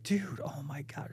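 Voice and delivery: slow, stunned whispered dismay; shocked whisper. Retold or imitated. Reacting to friend hiding ED from wife, sad slow whisper. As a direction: whisper it, heartbroken for him. My heart was just like, it's just so sad.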